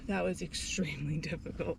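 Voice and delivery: soft tired laugh through words; relieved laughter. Real, not acted. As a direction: laugh it off, worn out. Laughs after finishing a tough hike.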